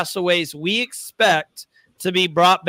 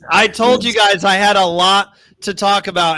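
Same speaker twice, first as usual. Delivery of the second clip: normal talking voice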